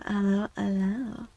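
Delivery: ordinary talk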